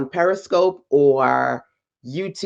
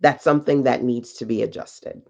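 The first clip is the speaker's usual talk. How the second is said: ordinary talk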